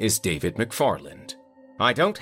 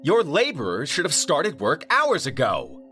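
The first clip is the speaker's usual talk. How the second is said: higher, louder irate caller; angry client; 12 semitones higher, 4 dB louder than usual. A narrator's reading. Vineyard owner complaining to the manager, irate. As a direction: demand answers, fed up.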